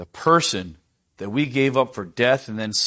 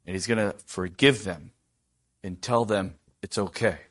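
ordinary talk

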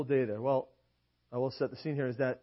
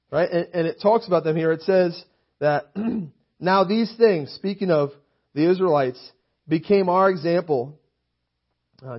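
raised voice reading scripture aloud; preaching; 3 semitones higher, 8 dB louder than usual. Acted. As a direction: read the verse out like a preacher.

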